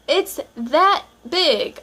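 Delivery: drawn out for emphasis. Acted. Showing the tiny paper size.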